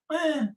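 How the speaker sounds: high, short, scornful "eh" grunt; dismissive eh. Retold or imitated. Americans brushing off the media, a high scornful grunt.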